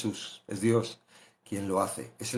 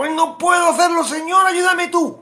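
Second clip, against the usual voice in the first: desperate plea; 4 dB louder than usual. Acted. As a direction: beg the Lord, pitched way up and stretched. Acted prayer when giving up.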